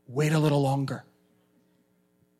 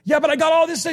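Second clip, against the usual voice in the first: protesting believer; 11 semitones higher, 7 dB louder, faster than usual. Retold or imitated.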